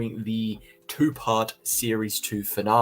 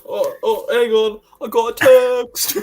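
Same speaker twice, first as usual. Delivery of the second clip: put-on British accent; 12 semitones higher, 6 dB louder than usual. Mocking co-host's British phrasing.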